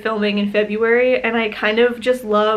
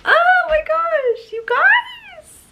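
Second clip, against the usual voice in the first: squeaky, choked-up, slow happy crying; overwhelmed fan thanks viewers through real tears; much slower than usual. Real, not acted. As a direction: voice cracking high into happy sobs. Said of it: "I'm, like, crying".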